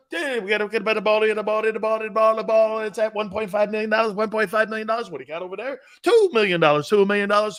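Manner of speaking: fast, loud auctioneer patter calling bids. Pretend.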